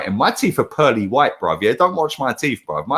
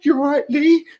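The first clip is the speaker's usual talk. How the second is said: high-pitched put-on heckler voice; mock timid greeting; 10 semitones higher, slower than usual. Mimicking the shaky heckler greeting him, mock timid. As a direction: imitate a nervous hello, pitched well up.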